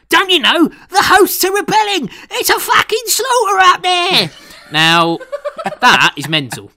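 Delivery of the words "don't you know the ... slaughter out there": put-on very high, loud English-accented shout; hammed-up frantic English student actor. English student extra giving it their all in a random line, overacted panic in an English accent. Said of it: "An English student actor".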